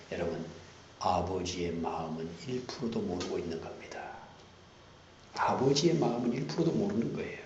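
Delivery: usual speaking voice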